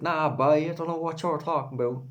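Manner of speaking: high, sing-song Cork accent imitation. Cork friend brushing him off, in a high Cork accent. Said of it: "She was like".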